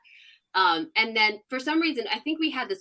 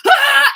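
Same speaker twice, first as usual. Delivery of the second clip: high, loud elated scream; joyful scream; 14 dB louder than usual. Retold or imitated. Photographer streaking past the camera, screaming with joy. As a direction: let out a wild victory scream. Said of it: "you can hear him screaming".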